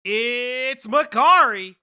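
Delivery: slow, loud, stretched hype intro; guest announcement. Acted.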